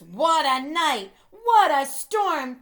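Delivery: light, excited bird chatter; twittering wren. Acted. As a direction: chirp it out like an excited little bird. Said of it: "twitters Wen".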